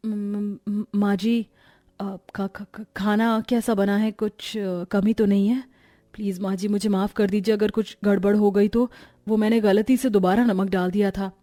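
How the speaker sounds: timid, stuttering daughter-in-law; frightened stammer. Acted. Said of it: "इतना डर क्यों रही है".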